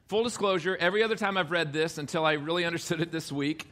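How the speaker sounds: ordinary delivery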